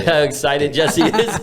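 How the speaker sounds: slow teasing through laughter; amused, drawn-out words; laughing. Real, not acted. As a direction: laugh as you tease your friend. Teasing friend while laughing.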